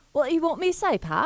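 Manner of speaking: timid Love Island-style British attempt; attempted British accent. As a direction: try out a British accent shyly. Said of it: "Yeah, that is terrible".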